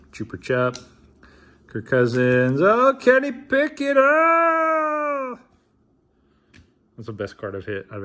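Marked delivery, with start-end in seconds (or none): 2.6-5.4 high, loud yell of excitement; excited cry. Real, not acted. Card opener reacting to the best pull, thrilled high shout. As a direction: blurt the name, then hold a high "Oh!".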